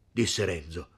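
plain reading voice

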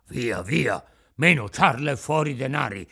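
loud, menacing bandit shout; threatening shout. Acted. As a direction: bark the demand at him. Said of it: "gridavano minacciosi". (grido minaccioso)

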